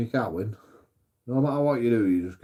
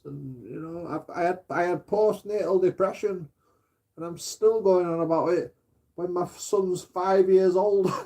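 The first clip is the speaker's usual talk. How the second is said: mock little girl; 7 semitones higher, slower than usual. Mocking girlfriend playing victim for police, high little-girl voice. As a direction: whimper it like a helpless little girl. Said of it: "as soon as police are there".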